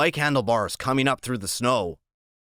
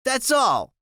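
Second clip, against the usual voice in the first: put-on slurpy drunk; 13 semitones higher, 3 dB louder than usual. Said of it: "her voice is slurpy".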